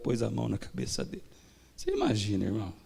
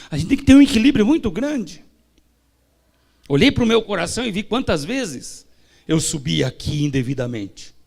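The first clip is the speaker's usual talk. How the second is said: loud, high sermon delivery; fervent preaching; 6 semitones higher, 7 dB louder than usual. Acted. Preacher exhorting the church, loud and high. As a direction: preach it with full force.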